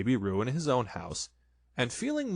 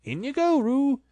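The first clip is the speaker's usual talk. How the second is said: high, loud call to Roo; 5 dB louder than usual. A narrator's reading. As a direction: sing out the order, loud and high. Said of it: "Rabbit said in a loud voice".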